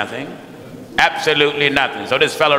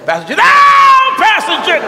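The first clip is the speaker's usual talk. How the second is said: mock yelling; 6 dB louder, faster than usual. Preacher mimicking visitors to his office, mock yelling. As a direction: pitch leaping up in a mimicked cry. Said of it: "jerking and shaking and yelling".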